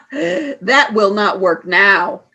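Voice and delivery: gloating laugh; mocking laugh. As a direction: laugh at him cruelly. Villain mocks trapped man.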